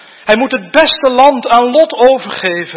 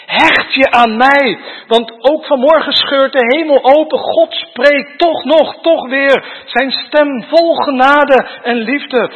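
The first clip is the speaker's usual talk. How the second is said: raised, driving sermon voice; preaching at full force; 4 semitones higher, 3 dB louder than usual. Acted. Preacher urging the congregation, at full force. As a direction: preach it loud and urgent to the congregation.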